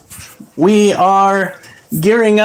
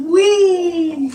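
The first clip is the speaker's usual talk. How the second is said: playful cheer; 9 semitones higher than usual. Real, not acted.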